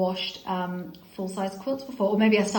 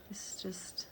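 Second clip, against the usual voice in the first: a hushed whisper of wonder; awed whisper; 15 dB quieter than usual. Real, not acted. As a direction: whisper it as if it were a treasure. Leafing through the book in awe.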